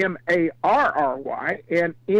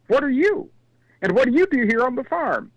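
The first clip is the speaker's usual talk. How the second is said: high-pitched zebra character asking questions; curious zebra; 7 semitones higher than usual. Acted. Zebra quizzing a farm animal, high curious voice. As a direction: raise the voice for a storybook animal. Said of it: "walked up to the animal and said".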